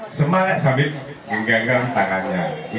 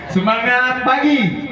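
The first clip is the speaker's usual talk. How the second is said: much higher shouted, slow call; loud cheer call; 11 semitones higher, much slower than usual. Shown as a demonstration. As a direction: shout it to fire them up. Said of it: "Maka saya harus ku-, lagi".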